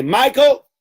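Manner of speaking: high, loud call of the name; stern teacher calling out; teacher barking a name. Retold or imitated. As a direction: summon the boy sharply. Strict teacher calling a pupil.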